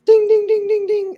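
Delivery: high sung dings like a bell; vocal elevator ding. Acted. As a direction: chime like an elevator arriving.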